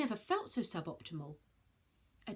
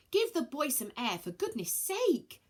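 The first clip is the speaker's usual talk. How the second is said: relieved; 7 semitones higher, 3 dB louder than usual. Acted. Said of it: "a relieved Athene".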